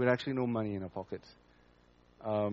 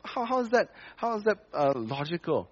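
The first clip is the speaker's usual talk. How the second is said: much higher, incredulous outrage; a retold objection pitched way up; indignant protest; 11 semitones higher than usual. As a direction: protest the unfairness in disbelief. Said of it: "I say".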